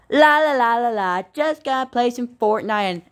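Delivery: put-on high, singsong little kid voice; chirpy kid humming to himself; goofy kid voice. Johnny singing, playing alone.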